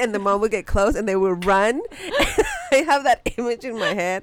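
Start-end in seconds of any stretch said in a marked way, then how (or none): 1.9-2.7 a high burst of giggling; high giggle. Real, not acted. Laughing about her cousins running from their mom. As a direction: giggle at the memory.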